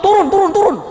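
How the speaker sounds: high, urgent repeated command; acted outburst, rapidly rising in pitch; angry order. Crash victim ordering the driver out, angry order.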